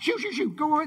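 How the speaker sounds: high, hurried shooing away; playful shooing. Pretend. Jesus sending the risen crowd back, playful shooing. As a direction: shoo them off in a comic fluster. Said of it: "he'd have to send them back".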